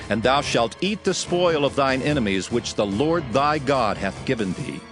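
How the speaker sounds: ordinary talk, nothing marked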